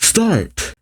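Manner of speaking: restrained, high starting call; yelled command. Acted. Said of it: "The bird keeper then yells out".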